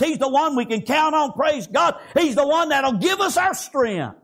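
full-voiced, chanted sermon delivery; fervent preaching. Real, not acted. Preacher urging congregation to draw strength from God, fervent preaching.

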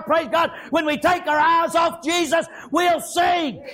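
high, driving sermon cadence; full-force preaching. Real, not acted. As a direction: preach it hard, phrase after phrase.

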